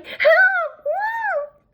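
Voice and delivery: very high, loud cry for help; a girl screaming for help; shouting for help. A narrator's reading. The girl runs out the door shouting for help.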